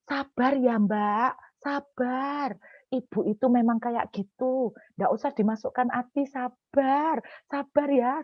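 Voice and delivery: imitating sympathetic churchgoers hushing her; consoling congregation. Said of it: "Terus bilang gini".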